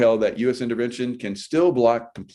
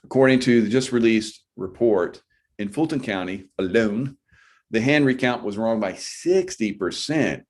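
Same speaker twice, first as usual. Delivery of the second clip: ordinary talk, nothing marked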